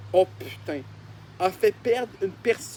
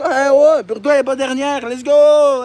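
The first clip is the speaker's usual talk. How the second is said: loud, high burst of joy; excited cheer; 5 semitones higher, 11 dB louder than usual. Real, not acted. Cheering Birdo for not finishing last, loud and excited. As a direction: cheer her on like a goal was scored.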